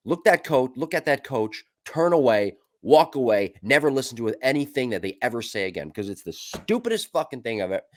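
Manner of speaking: high, loud, emphatic rant; heated rant. Real, not acted. Host urging players to ignore coaches, heated rant. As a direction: fire up the listeners against bad coaches. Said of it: "ranting advice to listeners".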